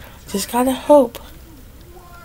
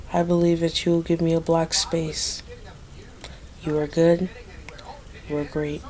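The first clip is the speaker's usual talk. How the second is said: mock prayer; 9 dB quieter than usual. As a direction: beg the Lord softly like in church. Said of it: "I'm praying".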